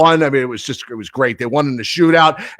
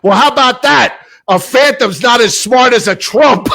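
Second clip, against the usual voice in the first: yelling out; 7 semitones higher, 3 dB louder than usual. Retold or imitated. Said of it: "I yell out".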